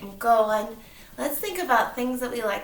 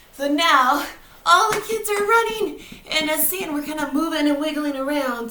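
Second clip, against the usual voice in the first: excited; 5 semitones higher, 6 dB louder than usual. Pretend. Excited beach scene.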